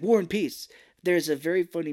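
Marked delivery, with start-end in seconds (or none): 0.0-0.7 quick, proud blurt of the answer. Retold or imitated. Raphael answering the trivia question with a quick, mildly excited blurt. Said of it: "and he's like".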